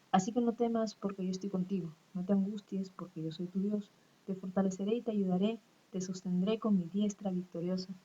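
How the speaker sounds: soft, hushed reading of a Bible verse; scripture reading. Acted. Teacher reading a comforting verse to her students, softly. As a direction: read the verse gently like a prayer.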